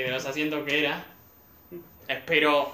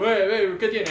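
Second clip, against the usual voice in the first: mock shout; 6 semitones higher, 6 dB louder than usual. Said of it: "Era como estaba gritando".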